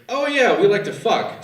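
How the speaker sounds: high, loud sudden recollection; light bulb moment, hammed up; mock realization. Exes remembering at the bar, high mock delight. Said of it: "then we remembered".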